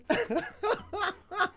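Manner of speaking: high-pitched imitated giggle of the old student; imitated laugh. Old student recalling skipping school, high giggle. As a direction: giggle high, as the eighty-two-year-old did. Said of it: "Then he just started laughing".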